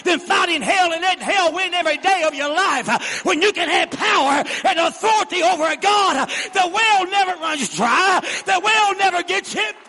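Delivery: full-throated revival preaching; preaching at full force. Acted. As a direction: drive it home to the congregation. Preaching climax to congregation.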